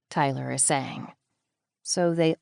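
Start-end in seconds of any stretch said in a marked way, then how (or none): none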